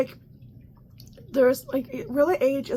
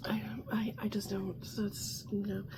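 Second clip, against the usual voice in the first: softer, whispered trailing off; quiet bewildered trail-off; hushed disbelief; 3 semitones lower, 10 dB quieter than usual. Real, not acted. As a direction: let it fade in a whisper.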